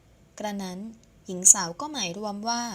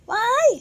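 startled shriek; 7 dB louder, much slower than usual. Acted. Being scooped up in arms.

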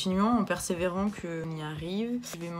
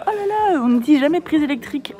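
high, loud prissy mockery; 7 semitones higher, 12 dB louder than usual. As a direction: ham up a fussy prim lady. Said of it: "on va faire semblant".

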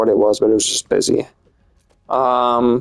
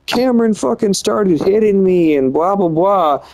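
higher-pitched mock complaint; mocking imitation; 7 semitones higher than usual. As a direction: mimic a tattling partner dismissively. Mocking girlfriend's lie to the parents.

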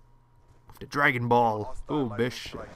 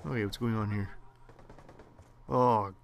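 ordinary talk, nothing marked

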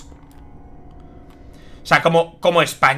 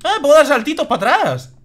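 excited; 9 semitones higher, 4 dB louder than usual. Real, not acted. Discovers a new move, thrilled.

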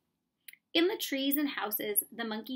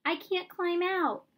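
crying out; 8 semitones higher, 4 dB louder than usual. Acted. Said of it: "Mowgli cried".

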